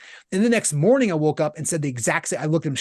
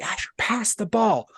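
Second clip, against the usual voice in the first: drawn-out, higher-pitched mock yell without real volume; imitated sideline yell, slow and raised in pitch; retold yell; 5 semitones higher, slower than usual. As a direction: re-enact yelling at a player, held back. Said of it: "I just yelled".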